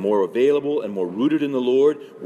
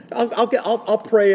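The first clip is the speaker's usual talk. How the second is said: higher, hurried excuses from a busy priest; mock the priest who puts prayer off; excuse-making priest; 9 semitones higher, 4 dB louder than usual.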